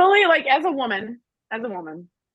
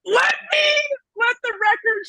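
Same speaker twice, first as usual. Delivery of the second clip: excited declaration; 9 semitones higher, slower than usual. Real, not acted. Declaring she always hated him.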